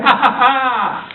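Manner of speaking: slow mock laugh, then a dare; drawn-out "ja, ja, ja" of disbelief; mocking scoffer. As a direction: imitate a scoffer laughing off the warning. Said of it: "le van a decir".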